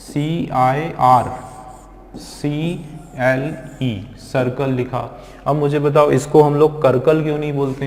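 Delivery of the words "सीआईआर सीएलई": letters spelled one by one, slow; slow spelling for students; spelled out slowly. Shown as a demonstration. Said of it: "आपने एक spelling लिखी".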